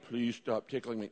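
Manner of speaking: mild, low and soft plea; halbherzige Bitte. Shown as a demonstration. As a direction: ask politely, softly, without any urgency. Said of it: "No, you go".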